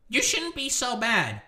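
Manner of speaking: high, slow, mocking scold. Parroting critics who tell him to calm down, high slow mockery. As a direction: drag it out in a high sneering voice. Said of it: "Why are you so mad?".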